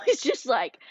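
usual speaking voice

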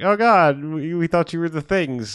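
a frightened survivor blurting out relief; panicked. Retold or imitated. Survivor apologizing after the axe blow, in mock panic. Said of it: "the panicked people that are like".

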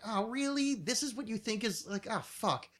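high, incredulous "really", then let down; retold disappointment. Host recalling the first track, disappointed disbelief. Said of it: "I was, like, so disappointed".

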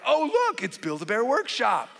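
hammed-up fake delight to change the subject; mock excitement. Dad distracting his daughter, mock excited and high.

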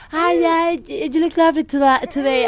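high-pitched gushing fake compliment; put-on sweet social voice; politely fake. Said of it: "too politely fake".

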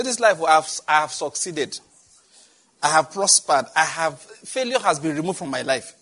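loud, emphatic declaration; declaration for the congregation to repeat. Shown as a demonstration. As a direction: proclaim each line for the church to echo.

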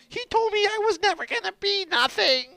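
very high whiny sob voice; mock his teary complaint, high; imitating Sheik crying. Sheik retelling what Roger said, high and whiny. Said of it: "He's like".

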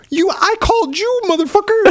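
high, exasperated mock outrage; incredulous. To the scam caller who phoned back, high mock outrage. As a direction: fire back at the caller in disbelief. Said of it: "And I'm like".

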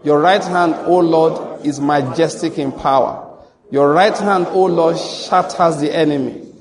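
loud, proclaiming verse reading; emphatic scripture reading. Shown as a demonstration. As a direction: declaim it to the congregation. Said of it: "One, two, let's go".

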